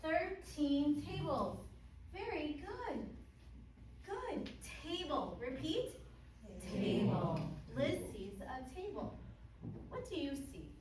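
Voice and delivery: slow, high, loud and beaming; over-enunciate for beginner English learners; kids show host. Shown as a demonstration. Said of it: "But I want to do one with you".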